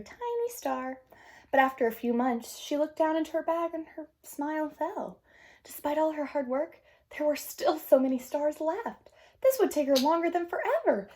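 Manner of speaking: put-on gentle read-aloud to children; storybook narration. A narrator's reading. Teacher reading a picture book to the class, gentle narration.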